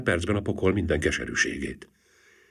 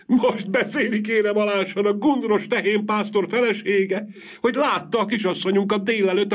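agitated servant; 11 semitones higher than usual. Acted. Excited servant reports the sighting.

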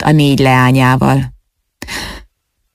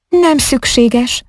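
high, slow, frosty dismissal; cold refusal; 8 semitones higher, slower than usual. Acted. Ágnes refuses the knight's escort, cold and slow. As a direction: turn him down coolly and slowly. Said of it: "szólt hidegen, elutasítón".

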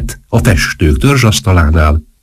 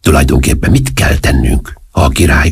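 ordinary delivery

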